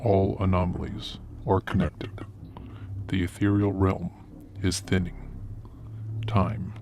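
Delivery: ominous narration. Acted. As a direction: announce the dark mission. Ominous foundation intro narration.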